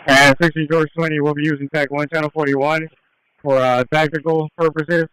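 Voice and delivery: police radio with crackling bursts; like a squad car radio call; radio static bursts. Real, not acted.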